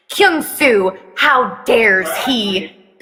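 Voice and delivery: slow mock outrage; understated fake indignation; feigned anger. Mom pretending to be mad at Kyung-soo, mock anger. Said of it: "Baekhyun feigns anger".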